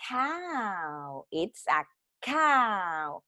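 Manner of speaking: very slow teacher delivery; drawn-out modelling. Shown as a demonstration. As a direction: stretch each word out for young learners. Teacher reveals and models the word. (pronunciación muy lenta y alargada)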